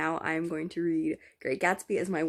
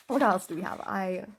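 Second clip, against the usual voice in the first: normal talking voice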